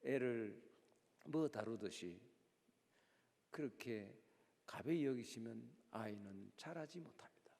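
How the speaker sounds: low, soft, half-whispered blessing; hushed prayerful close. Acted. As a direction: bless the congregation quietly and earnestly. (낮고 조용한 축복 기도)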